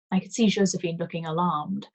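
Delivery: usual speaking voice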